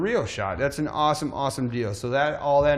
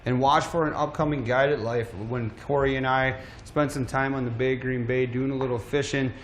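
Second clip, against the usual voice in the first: usual speaking voice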